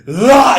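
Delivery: high, loud sung "La" like casting magic; casual spell sound. Retold or imitated. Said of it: "even just going".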